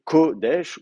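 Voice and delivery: Kodesh with a drawn-out, stressed KO and a light -desh; stress on first syllable. Shown as a demonstration. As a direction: lean on the Ko of Kodesh. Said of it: "l'accent est sur la première syllabe".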